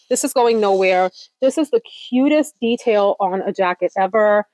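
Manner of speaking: ordinary delivery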